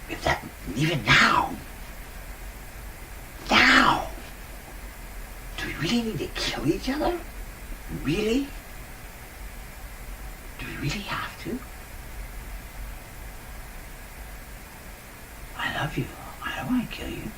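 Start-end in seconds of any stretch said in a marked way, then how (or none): 0.8-8.5 high, urgent plea; high pleading. Real, not acted. Pleading against killing. 10.6-17.2 soft plea. Real, not acted. Pleading against killing.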